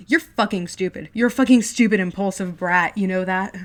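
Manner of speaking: loud contemptuous yell; older brother insulting him loudly; insulting shout. Acted. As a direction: sneer and yell.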